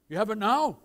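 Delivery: loud imitated prying question; blunt father. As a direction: blurt it like a tactless dad.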